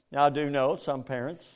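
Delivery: usual speaking voice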